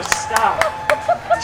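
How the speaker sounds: high squeak. Real, not acted. Pleading laughing stop.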